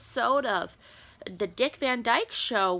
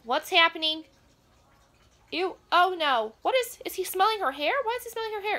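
higher and louder, rising alarm; alarmed outburst; 7 semitones higher, 6 dB louder than usual. Real, not acted. Scandalized viewer reacts to hair smelling, alarmed higher and louder. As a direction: blurt it out, scandalized and rattled.

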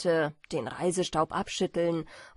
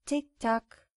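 "Tick-Tack" as two separate, falling beats; tired old clock; 4 semitones higher than usual. A narrator's reading. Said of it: "wie das Schlagen einer alten, müden Uhr". (wie eine alte, müde Uhr)

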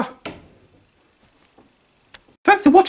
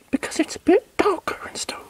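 whisper; 8 dB quieter than usual. Real, not acted. Darker scene, whispered.

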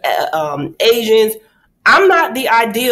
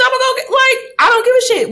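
defiant; faster than usual. Retold or imitated. Defiant punchline.

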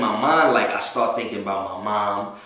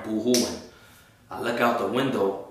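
ordinary talk, nothing marked

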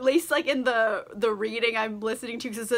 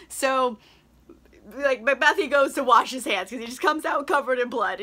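ordinary talk, nothing marked

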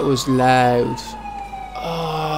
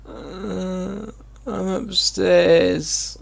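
weak injured voice; 6 semitones higher, much slower than usual. Acted. Injured, answering the rescuer.

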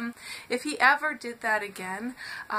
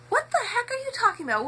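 incredulous retort; 11 semitones higher, much faster than usual. Retold or imitated. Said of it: "I was like".

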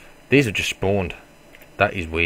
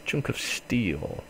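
ordinary talk

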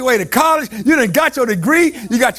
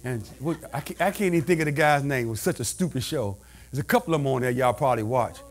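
much lower, softer, fast side remark; quiet aside; 10 semitones lower, 9 dB quieter than usual. Acted. Preacher muttering a fast aside to the church. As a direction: drop into an offhand mutter.

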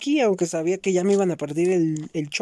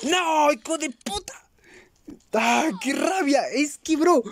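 high, outraged cry at dying; gaming rage; 9 semitones higher than usual. Real, not acted. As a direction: vent fury after getting killed. Gamer raging after being killed. (grito de rabia al morir en el juego)

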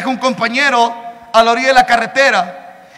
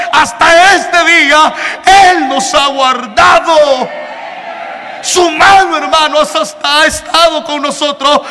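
loud, slow, higher preacher's cry; fervent preaching; 4 semitones higher, 4 dB louder than usual. Acted. Preacher to congregation about God's mercy, loud, slow and higher. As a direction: proclaim God's mercy at full force.

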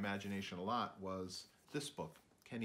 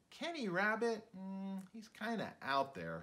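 put-on higher, teasing kid voice; a hint of a kid rolling their eyes; mock schoolkid gossip; lightly voiced classmate; 9 semitones higher, slower than usual.

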